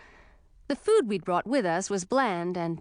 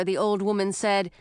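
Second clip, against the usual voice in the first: ordinary delivery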